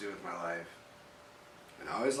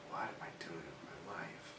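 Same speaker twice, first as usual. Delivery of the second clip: softer, lower, turned inward; quiet inner thought; 3 semitones lower, 13 dB quieter than usual. Retold or imitated. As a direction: mutter the doubt to yourself. Inner thought, sudden self-doubt.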